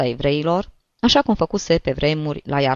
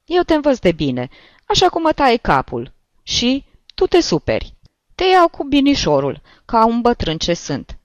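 put-on higher, louder old man's chiding voice; old priest scolding; 9 semitones higher, 4 dB louder than usual. A narrator's reading. Old priest scolding the rude Solomon, higher and louder. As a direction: chide him like an exasperated old priest.

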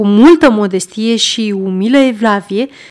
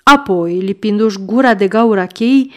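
plain reading voice

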